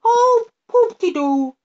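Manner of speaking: pooh-pooh her like a giddy imp; the fly-by-night mocks the girl's worry, squeaky and high; fly-by-night crying out. A narrator's reading. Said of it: "cried the fly-by-night".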